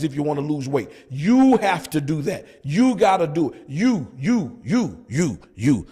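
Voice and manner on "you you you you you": repeated you. Shown as a demonstration. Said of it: "Let me say it with a deep voice".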